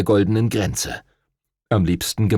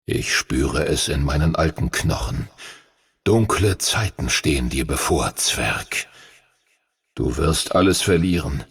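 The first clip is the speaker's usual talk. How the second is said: much lower, dark prophecy fading to a breath; ominous old dragon; 5 semitones lower than usual. A narrator's reading. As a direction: sink deep and let the last words die away.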